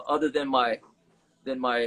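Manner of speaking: ordinary delivery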